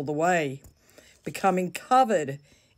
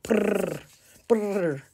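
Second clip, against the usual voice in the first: a higher, lip-trilled brrr like teeth chattering; shivering brrr; 4 semitones higher than usual. Acted. Girl doused with cold hose water, shivering brrr.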